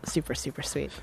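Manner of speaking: super sweet breathed in a whisper. Real, not acted. Whispering praise of the remix to listeners. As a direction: hush it like a secret.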